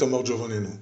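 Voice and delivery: usual speaking voice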